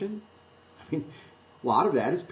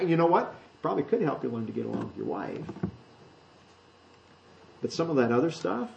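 usual speaking voice

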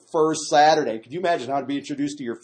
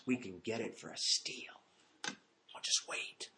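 hushed conspiratorial murmur, very soft; plotting; 3 semitones lower, 12 dB quieter than usual. Acted. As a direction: scheme secretly with an accomplice, pausing between phrases. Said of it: "they're plotting and they're saying".

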